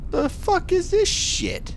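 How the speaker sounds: mock outraged. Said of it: "I'm gonna be like".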